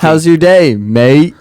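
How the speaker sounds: loud mock Australian accent; hammed-up Aussie greeting; Kevin. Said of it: "like Kevin in The Office".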